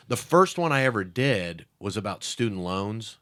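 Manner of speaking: normal talking voice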